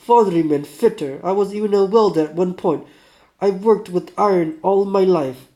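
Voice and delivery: put-on loud, emphatic Russian accent. Sick ironworker telling his trades, emphatic Russian accent. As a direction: list your trades loudly in a Russian accent. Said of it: "do my best, uh, Russian".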